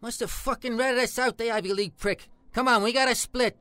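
loud, agitated cursing; curse, then loudly urge him to move; angry urgency. Acted. Telling a companion they must flee police, urgently angry.

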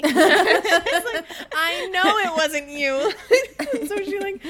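high, slow retort through laughter; laughing mock exasperation. Cinder to the friend who punched her, laughing mock exasperation. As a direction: imitate an exasperated retort while cracking up.

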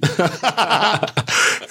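high, hearty laugh; real laughter. Real, not acted. Laughs about wee Mo's managers.

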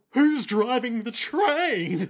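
hammed-up suspicious outcry; mock alarm. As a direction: draw it out as a dawning horror. Acting out the dawning realisation.

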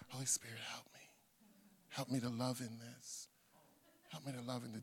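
very soft, low plea under the breath; muttered prayer. Acted. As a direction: murmur a private prayer for help. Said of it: "let it be saying things like".

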